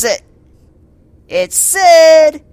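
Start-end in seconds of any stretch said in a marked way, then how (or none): 1.3-2.4 higher, louder, thrilled reveal; excited surprise. Real, not acted. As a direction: gasp out who saved him. Surprise rescue.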